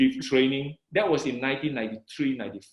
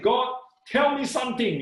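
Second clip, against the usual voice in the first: high, eager plea to God; retold eager prayer; excited prayer; 7 semitones higher than usual. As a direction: ask God eagerly, pitch raised. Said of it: "I was just too excited".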